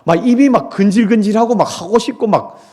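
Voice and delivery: hammed-up eagerness to spill it; eager itch. Itching to gossip, animated. (입이 근질근질해 못 참는 듯 들떠서)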